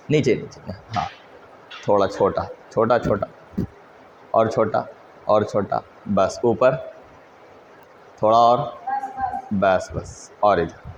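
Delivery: soft side instructions about shrinking the picture; quiet aside. Real, not acted. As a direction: drop aside to guide a helper off camera. Off-mic instructions to helper.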